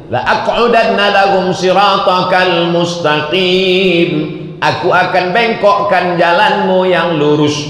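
high, ringing recitation and translation; declaimed vow. Retold or imitated. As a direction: proclaim the vow to the congregation. Declaims Satan's vow from Quran.

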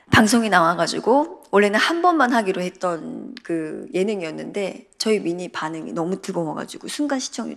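normal talking voice

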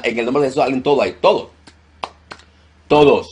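loud, high, commanding rebuke of demons; fiery deliverance preaching. Acted. Pastor commanding demons to leave the congregation, shouted. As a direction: cast the demons out at full force.

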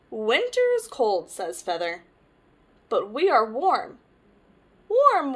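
lively picture-book read-aloud; reading to children. Acted. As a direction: tell it to little ones, brightly.

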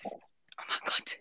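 breathless whisper, slow and soft. Real, not acted. Caller whispering to her idol in awe, softly. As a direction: gasp it out under your breath, overwhelmed.

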